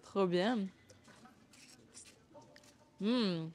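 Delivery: talking while chewing taffy; chewing sticky toffee. Real, not acted. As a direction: mouth full of sticky taffy. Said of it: "T'aimes trop?". (la bouche pleine de tire collante)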